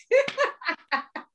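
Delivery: real high-pitched laugh; laughing hard at the memory; high burst of laughter. Real, not acted.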